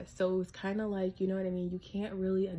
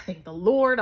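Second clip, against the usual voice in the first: a much louder, relieved burst of thanks; relieved exclaim; 11 dB louder than usual. Retold or imitated. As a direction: call it out loud, like a weight just lifted. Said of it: "I was like".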